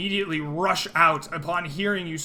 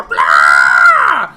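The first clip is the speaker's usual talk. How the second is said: loud, sustained mock bleating roar; 12 semitones lower, 18 dB louder than usual. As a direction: let out a big roar on the GM's turn. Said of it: "these goblins hear you roar".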